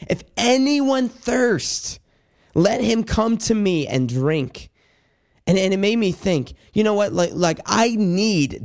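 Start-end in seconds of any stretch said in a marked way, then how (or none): 0.1-4.7 high, slow, drawn-out call; crying out. Retold or imitated. Preacher voices Jesus crying out at the feast, high and slow. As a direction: voice Jesus calling to the crowd, lifted high. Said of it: "it says that Jesus stood up and cried out".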